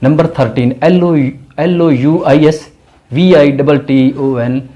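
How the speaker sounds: ordinary talk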